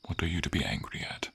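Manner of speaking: ordinary talk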